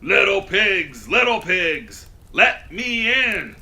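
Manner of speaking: loud, slow wolf calling at the door; big bad wolf. A narrator's reading. Wolf calling loudly to the little pig. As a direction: boom it out like a hungry wolf at the door. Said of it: "knocked on the door and said".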